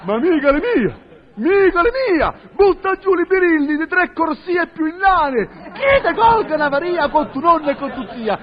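ordinary delivery

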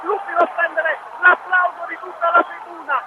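rising Italian radio goal call; breathless italian goal commentary. Real, not acted. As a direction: build to the goal and shout it. Live goal play-by-play.

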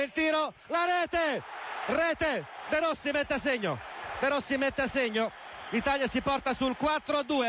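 high shouted goal call; excited goal call. Real, not acted. De Rossi scores penalty.

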